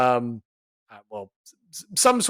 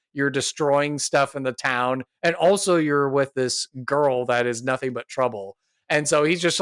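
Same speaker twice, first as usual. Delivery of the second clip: normal talking voice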